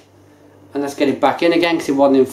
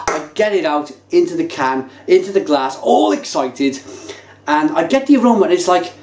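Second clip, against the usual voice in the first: ordinary talk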